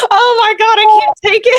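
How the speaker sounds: high, teary mock wail, voice cracking up; crying out. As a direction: relive the moment you broke down over the book, crying. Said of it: "And I'm like".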